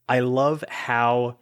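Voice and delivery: usual speaking voice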